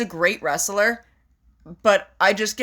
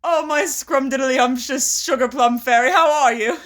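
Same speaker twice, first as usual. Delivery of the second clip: hammed-up British accent, pitched high and louder; terrible British accent; 5 semitones higher, 3 dB louder than usual. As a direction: ham up a badly faked, drawn-out British accent. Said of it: "It's a terrible British accent".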